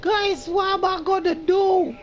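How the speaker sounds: high, loud, panicked plea; panicked high cry. Real, not acted. Panics with no bullets.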